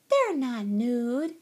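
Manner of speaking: high, loud, drawn-out contradiction; little girl teasing. A narrator's reading. Girl corrects her sister, teasing. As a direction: contradict your sister slowly and loudly.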